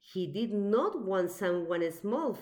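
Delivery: usual speaking voice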